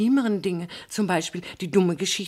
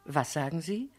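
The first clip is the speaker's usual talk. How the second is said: ordinary delivery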